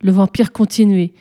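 ordinary delivery

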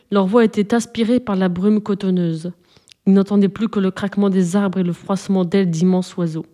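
usual speaking voice

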